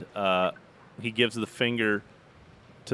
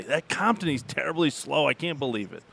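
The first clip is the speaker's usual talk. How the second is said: pitched-up mock complaint, higher voice; mimicked golfer; 5 semitones higher than usual. Lingmerth griping to the caddie about Compton, high mock complaint. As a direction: put on a higher voice to mock his gripe. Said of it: "Lingmerth would say".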